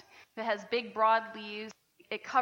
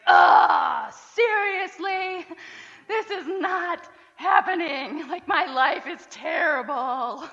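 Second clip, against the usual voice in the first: drawn-out, loud, higher mock wail, half laughing; whiny melodramatic complaint; 7 semitones higher, 12 dB louder, much slower than usual. Jonah groaning to God about the dead plant, hammed-up whiny shout. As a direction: ham up a sulking prophet moaning about his lost shade. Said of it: "He's like".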